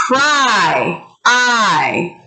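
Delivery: "pry" then "I", each drawn out with falling pitch; isolated long I. Shown as a demonstration. Teacher helps class decide the vowel, "pry, I" with long I drawn out. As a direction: hold the vowel of "pry", then repeat it alone. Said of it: "decide which vowel goes where".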